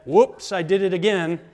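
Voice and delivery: loud mock-innocent quotation. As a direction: toss out the pop line loudly for laughs. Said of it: "theologian [name] said".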